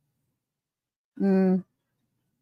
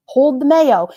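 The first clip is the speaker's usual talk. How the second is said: a bright, louder call of the order; emphatic order; 6 semitones higher, 8 dB louder than usual. Acted. To the cook, repeating her order loudly and firmly.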